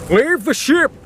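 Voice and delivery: high, urgent shouted order; captain calls everyone to abandon ship, urgent shout. Acted. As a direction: yell the order over the storm. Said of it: "the captain shouted to everyone".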